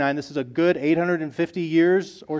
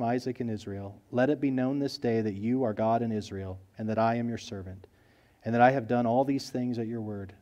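soft, lower, calm petition; quiet simple prayer; 4 semitones lower, 8 dB quieter than usual. A narrator's reading. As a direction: pray humbly and simply, no show. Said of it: "listen to his prayer compared to the prayers".

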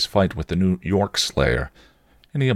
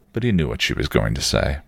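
plain reading voice

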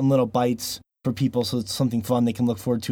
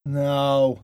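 ordinary talk, nothing marked

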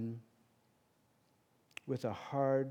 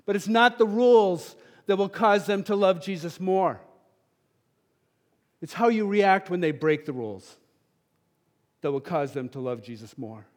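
much louder retold appeal to teachers; impassioned plea; 5 semitones higher, 11 dB louder than usual. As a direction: drive the point home hard. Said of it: "I turned to the teachers and I said".

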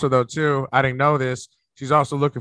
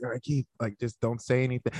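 discreet aside; 2 semitones lower, 11 dB quieter than usual. Retold or imitated. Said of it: "kinda wanted to go like this".

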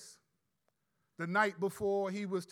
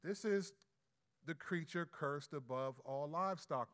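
ordinary delivery

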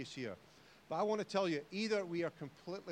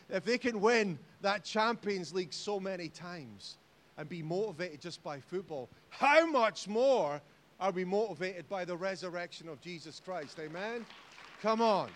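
preaching at full force; 2 semitones higher, 5 dB louder than usual. Acted. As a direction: drive it home to the saints. Sermon climax.

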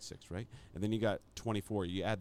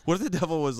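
usual speaking voice

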